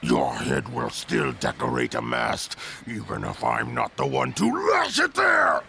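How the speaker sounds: menacing threat. Acted. Villain threatens hero in battle.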